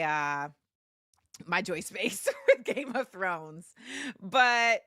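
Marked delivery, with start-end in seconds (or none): none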